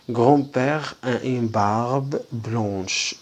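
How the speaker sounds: very slow, word by word; slow model reading. Shown as a demonstration. Teacher reading to pupils, very slow. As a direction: spell it out slowly for pupils. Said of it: "slow model reading".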